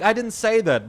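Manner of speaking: high, loud retold protest. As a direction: replay the denial with the voice pushed up. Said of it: "I raised my voice".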